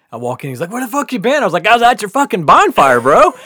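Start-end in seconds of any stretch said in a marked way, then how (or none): 0.7-1.4 mock yell. Friend yelling at him. 1.7-3.3 loud, high, laughing protest; louder, defending himself to his friend; indignant yell. Retold or imitated.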